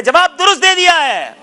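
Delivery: high, loud host's shout; soaring game-show call; triumphant announcement. Real, not acted. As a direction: proclaim the correct answer to the crowd. Quiz host announcing correct answer.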